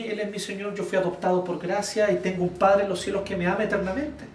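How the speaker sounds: ordinary talk, nothing marked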